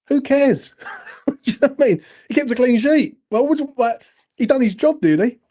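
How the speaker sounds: high, loud and fast, laughing in disbelief; exasperated outburst. Real, not acted. Ranting to chat about fans dismissing a clean sheet, high exasperated disbelief. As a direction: blurt it out like it's obvious.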